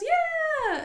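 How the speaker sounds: high, loud imitated cheer; hammed-up delight; gleeful cheer. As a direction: mimic an enthusiastic teacher squealing. Imitates teacher's gleeful reply.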